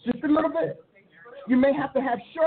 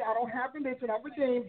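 ordinary talk, nothing marked

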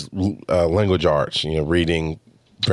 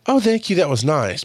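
high, sweet little acknowledgement; light high-pitched courtesy; polite mild thanks; 6 semitones higher than usual. Acted. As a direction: act out a merely polite compliment. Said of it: "not just confirmation of".

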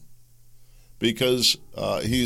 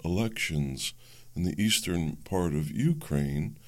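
hushed, measured public-radio host parody; quiet, over-careful radio presenter; NPR; 4 dB quieter than usual. Acted. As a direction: introduce a report in a soft, slow mock newsreader voice. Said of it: "N P R, you're hearing this".

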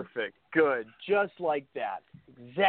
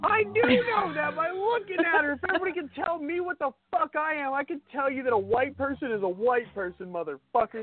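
high-pitched heated jab; heated retort; 9 semitones higher than usual. Real, not acted. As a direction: fire it back much higher. Heated argument over Warren.

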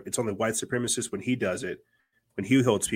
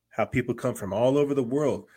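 normal talking voice

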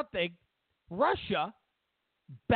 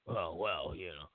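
sheepish mumble; 10 dB quieter than usual. Retold or imitated. Sheepish kid's mumbled excuse.

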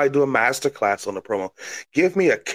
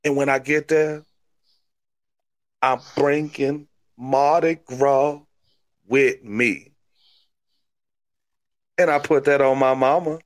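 crazy Cajun; 2 semitones higher than usual. Acted. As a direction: brag like a swamp wrestler in Cajun accent. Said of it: "You're a crazy Cajun".

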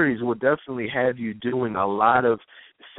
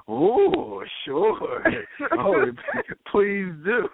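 put-on high, giggly, overeager girlfriend; hammed-up eager woman; mock eager; 7 semitones higher, much slower than usual. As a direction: play a woman barely hiding her delight. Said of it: "women get excited".

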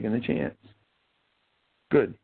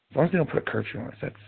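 ordinary delivery

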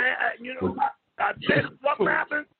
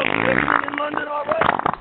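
put-on voice; 5 dB louder than usual. Calling out to friend overseas.

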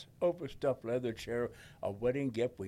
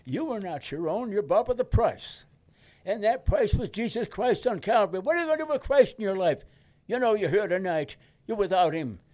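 loud, high, fervent revival preaching; louder and higher, urgent hellfire-style sermon delivery; preaching Billy Graham; 8 semitones higher, 9 dB louder than usual. Retold or imitated. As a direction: thunder it from the pulpit like a crusade evangelist. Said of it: "And this is what he said".

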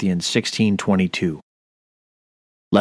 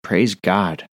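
ordinary talk, nothing marked

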